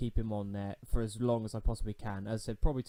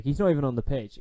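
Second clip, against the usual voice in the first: exasperated; 3 semitones higher, 7 dB louder, faster than usual. Real, not acted. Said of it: "for goodness sake".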